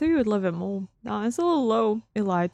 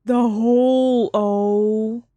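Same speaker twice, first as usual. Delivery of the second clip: stunned, slow, louder; 4 dB louder, much slower than usual. Real, not acted. Streamer spots the returning hole, awed and slow. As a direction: draw out the hole in awe.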